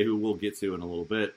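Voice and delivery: normal talking voice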